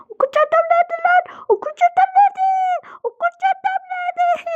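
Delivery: very high, squealed mock distress; shriek-wail the question in hammed-up despair; play-acted wailing. Pupil who lost the school bag, shrill panicked wail.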